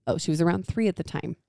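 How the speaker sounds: ordinary talk, nothing marked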